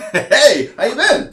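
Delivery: high, loud, happy hello; excited greeting. Acted. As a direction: greet an old friend brightly. GM voices NPC greeting friend.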